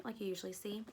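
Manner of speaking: ordinary talk, nothing marked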